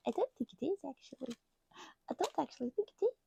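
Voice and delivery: high, doubting inner voice; doubting brain voice. Retold or imitated. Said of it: "As the brain says".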